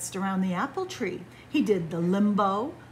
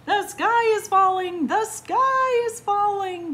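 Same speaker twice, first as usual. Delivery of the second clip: frantic chicken alarm; 13 semitones higher, 8 dB louder than usual. A narrator's reading. Dancing chickens spread the news, crying out in alarm. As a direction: sound the alarm at the top of your range.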